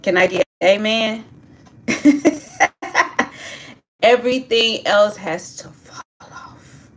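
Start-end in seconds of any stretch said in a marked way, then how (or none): 1.9-3.6 high-pitched staccato laugh; quick run of high giggles. Real, not acted. Laughs after asking for amen.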